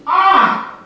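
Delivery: high, loud startled cry; yelped "Ah!", high and loud; sharp shout of pain. A narrator's reading. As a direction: cry out suddenly as if hurt. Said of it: "the boy shouted out instinctively".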